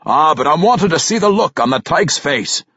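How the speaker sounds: folksy, relishing dwarf lilt; dwarf king. Acted. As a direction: play an eager old dwarf king.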